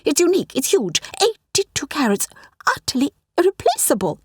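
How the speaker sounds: indignant. A narrator's reading. Curator's temper flaring.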